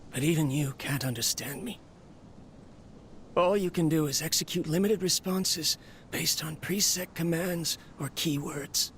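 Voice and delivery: normal talking voice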